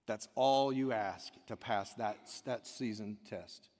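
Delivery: usual speaking voice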